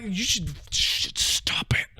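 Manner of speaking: hushed whisper telling them to stop; whispered rebuke. Retold or imitated. Whispering back at cringey millennials to stop. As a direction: breathe it out like a disgusted aside.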